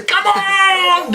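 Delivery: high shouted tennis fist-pump cry; Leighton Hewitt "Come on!". Retold or imitated. Imitating Leighton Hewitt's cry, a high hammed-up shout. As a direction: bellow it like a tennis champ. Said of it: "what's that fucking tennis player, Leighton Hewitt".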